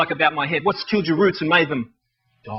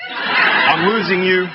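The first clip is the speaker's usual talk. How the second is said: slow, laughing refrain; much slower than usual. Acted. Poet to crowd, slow laughing refrain. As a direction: stretch it out, laughing.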